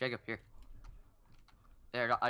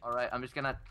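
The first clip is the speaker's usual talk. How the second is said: ordinary talk, nothing marked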